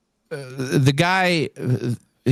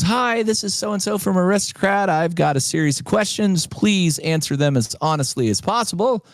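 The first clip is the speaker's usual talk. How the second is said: higher, prim businesslike voice; official caller lady; 8 semitones higher than usual. Retold or imitated. Caller from Aristocrat questions the winner, prim official. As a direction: read it like a formal call-centre script.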